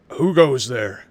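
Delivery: loud gruff challenge from the dock; gruff guard yell. Acted. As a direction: bark it out like a night guard. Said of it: "a gruff bo-, voice yell from the dock".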